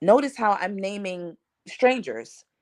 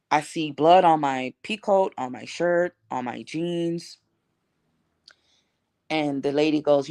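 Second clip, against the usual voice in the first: ordinary talk, nothing marked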